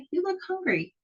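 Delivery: high, soft pitying little voice; high sympathetic girl. Acted. Sasha coos pityingly to the skinny dog. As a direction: coo it to the hungry dog.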